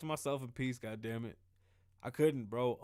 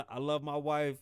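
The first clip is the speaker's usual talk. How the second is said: ordinary delivery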